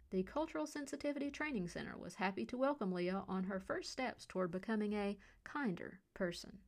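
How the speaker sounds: ordinary delivery, nothing marked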